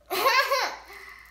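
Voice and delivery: high squeaky giggle; helium giggle. Real, not acted. Helium laugh.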